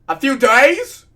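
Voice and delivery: very loud, high incredulous screech. A narrator's reading. Patient shocked by doctor's answer, screeched. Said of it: "I screeched".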